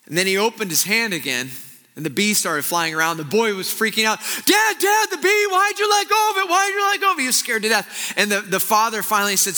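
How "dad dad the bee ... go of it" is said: put-on panicked child's shout. Terrified boy yells at father.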